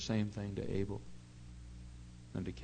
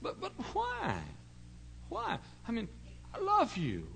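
pleading; 13 semitones higher, slower than usual. Retold or imitated. Preacher pleading with the boy, high and gentle.

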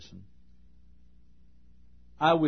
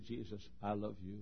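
quiet prayer; 3 semitones lower, 11 dB quieter than usual. Retold or imitated. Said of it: "and I may say".

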